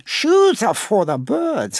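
"Shoes are for the birds" squawked high and slow; parrot voice. Acted. Parrot echoes the boy's words, high and slow. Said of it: "the parrot who lives in the shoe shop hears this".

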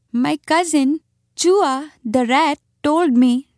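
high, halting little animal voice; timid muskrat. A narrator's reading. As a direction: confide nervously, piece by piece. Timid muskrat speaks, breaking off.

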